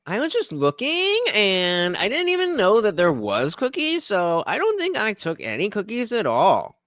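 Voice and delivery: put-on high, lilting kid making excuses; guilty daughter. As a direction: play a child denying it, phrases rising like questions. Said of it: "And she goes".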